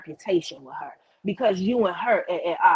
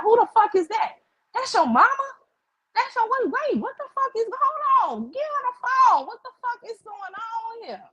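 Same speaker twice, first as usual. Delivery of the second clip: outraged, high-pitched yelling; high, incredulous outburst; retold angry demand. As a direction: re-enact indignant phone demands, voice shooting up. Retold angry demands over the phone.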